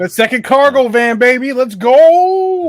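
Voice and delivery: building to a loud whoop; excited cheer. Real, not acted. Cheering about the money.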